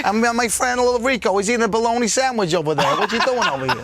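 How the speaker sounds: chummy New York wise-guy accent. Shown as a demonstration. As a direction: talk like a New Yorker showing off his pals. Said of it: "New York".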